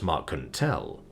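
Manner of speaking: plain reading voice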